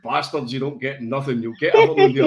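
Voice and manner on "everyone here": high, laughing through the words; giggling at the daft podcast. Real, not acted. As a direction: crack up as you say it. Laugh heard on line.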